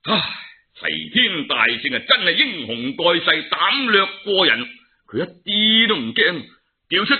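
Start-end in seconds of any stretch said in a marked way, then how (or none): none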